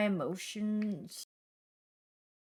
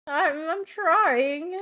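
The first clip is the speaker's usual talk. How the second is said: mock whine; 8 semitones higher, 6 dB louder, much slower than usual. Shown as a demonstration.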